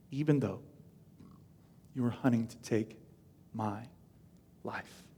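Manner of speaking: low, soft, broken by long pauses; hushed halting plea. Acted. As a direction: let it sink away word by word. David ends his plea, hushed.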